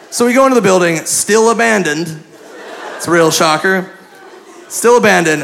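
ordinary talk, nothing marked

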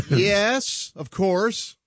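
ordinary delivery

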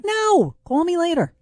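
high-pitched, exasperated retold refusal; emphatic brush-off. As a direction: cut the caller off with a high NO. Retells brushing off a caller.

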